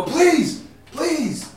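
high, loud desperate begging; man cowering from soldiers' guns, pleading; frightened pleading. Acted. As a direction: beg for your life, terrified. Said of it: "you hear a man go".

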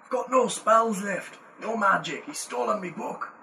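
light Scottish dwarf voice; the dwarf bargaining for freedom; Scottish accent. Acted. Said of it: "your fake Scottish accent".

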